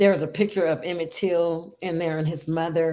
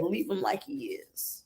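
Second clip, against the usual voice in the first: soft retold plea; 2 semitones lower, 10 dB quieter than usual. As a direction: say it softly, almost to yourself. Mother retells grieving mother's words.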